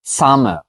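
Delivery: "zusammen" avalé en "sam"; raccourci. Shown as a demonstration. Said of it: "ils vont le raccourcir". ("zusammen" abrégé, juste "sam")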